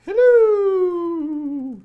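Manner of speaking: high, loud cartoonish hello; silly voice. Coder voicing his web page's greeting in a silly high, loud voice. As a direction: pipe it up high and loud like a cheery web page. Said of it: "I'm speaking in that voice".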